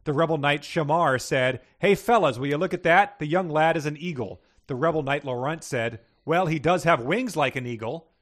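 lively read-aloud narration with a few voiced lines; reading a fantasy story to a co-host. A narrator's reading. Host reads story aloud.

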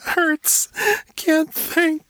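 put-on very high, sobbing child in pain; hurt boy crying, high-pitched. A narrator's reading. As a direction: gasp out single words through tears. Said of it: "the boy sobbed".

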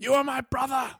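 high, loud anguished Obi-Wan cry; movie quote. Acted. Cohost answers a fan theory with a high, loud movie cry. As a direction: wail it like the betrayed Jedi.

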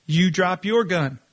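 ordinary delivery

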